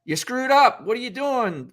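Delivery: hammed-up coach berating a player; imitating a yelling coach. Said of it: "The coach that goes".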